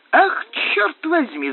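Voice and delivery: high, drawn-out exclamation; exasperated cry. A narrator's reading. Doctor reacting to Lanchester's answer, a high exasperated cry. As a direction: cry it out slowly, pitched right up. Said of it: "закричал доктор".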